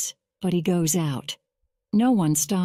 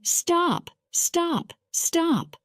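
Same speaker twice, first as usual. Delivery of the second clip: mock shouting; 10 semitones higher, much slower than usual. Driver retells the policemen's cry, mock high shout. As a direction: call it like police chasing a train. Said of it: "They're shouting".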